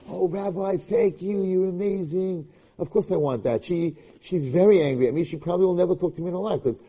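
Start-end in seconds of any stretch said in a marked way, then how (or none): 0.1-2.5 higher, slowed, swooning thank-you; mock gushing girl. Rabbi imitates the girl thanking him, mock gushing and higher. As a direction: gush over the rabbi like a grateful fan, hammed up. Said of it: "I want you to be like".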